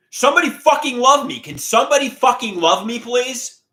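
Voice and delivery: high, loud desperate yelling; pretend scream. Imagined scream in a crowded church, begging for love. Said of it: "just start screaming".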